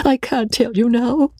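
high-pitched acted sob; sobbing. A narrator's reading. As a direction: sob the words out plaintively. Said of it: "sobbed Rebecca".

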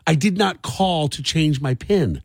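usual speaking voice

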